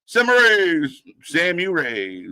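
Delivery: Samurai said with a "ray" ending; playful mispronunciation. Shown as a demonstration. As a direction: end Samurai on "ray", first high, then drawn out. Tries out the joke pronunciation.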